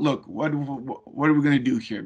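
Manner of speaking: ordinary talk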